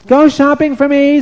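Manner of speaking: high, loud queen barking orders; a shrill-voiced wicked queen commanding her servants; bossy queen. Vashti orders girls on Shabbos.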